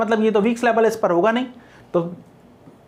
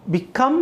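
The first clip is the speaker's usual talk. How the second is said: "become" with COME stressed; light be, then lean on the come of "become"; teacher's demo of "become", second syllable stressed; 3 semitones higher than usual. Shown as a demonstration. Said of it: "second syllable पर stress है".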